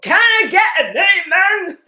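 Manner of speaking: high, loud, slow call for an amen; rally the room like a preacher. Pretend. Host rallying her live viewers, a loud preacher-style call.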